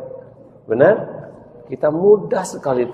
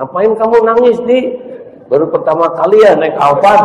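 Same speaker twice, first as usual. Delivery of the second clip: put-on higher, louder child teasing; high teasing kid; 10 semitones higher, 9 dB louder than usual. Friend teases Adi for crying in the car, high.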